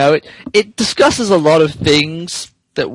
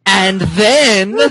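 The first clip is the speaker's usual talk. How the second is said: cracking up mid-read; high voice breaking into laughter; laughing through the words; 7 semitones higher than usual. Real, not acted. Breaking up while reading.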